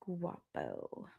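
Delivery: low growly name; deep voice. Pretend. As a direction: drop way down for the name. Repeats gator name very low.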